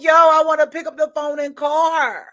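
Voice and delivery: loud, high-pitched venting; exasperated outburst. Retold or imitated. Wanting to call her cousin and unable, exasperated outburst.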